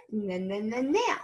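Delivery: "n, n, n, nail" with the N bounced, rising onto the word; repeated first sound. Shown as a demonstration. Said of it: "start with the n sound".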